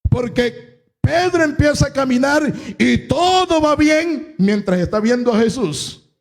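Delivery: high-pitched, driving sermon delivery; impassioned preaching. Real, not acted. Preacher telling the congregation how Peter walked to Jesus, impassioned preaching. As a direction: preach it with full conviction.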